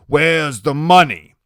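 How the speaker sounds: loud, slow threatening demand. A narrator's reading. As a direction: bark the question. Iggy demanding money.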